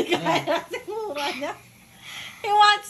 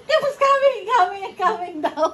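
amused echo; 2 semitones higher, 4 dB louder than usual. Retold or imitated. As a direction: echo the child, cracking up. Repeats child's remark while laughing.

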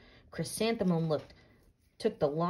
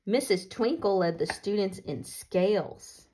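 ordinary delivery

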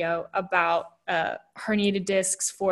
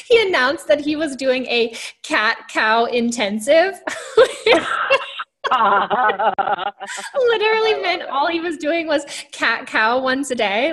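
talking through real laughter; laughing; 4 semitones higher, 4 dB louder than usual. Real, not acted. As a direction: giggle through the words. Laughing retelling funny husband story.

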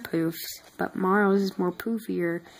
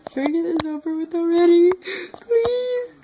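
high, fast whining plea; whiny tired voice; 10 semitones higher, 6 dB louder than usual. Acted. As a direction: whine like an exhausted kid.

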